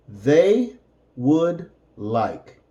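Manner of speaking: "They would like" said slowly, each word apart; slow teacher model; slow separated words. Shown as a demonstration.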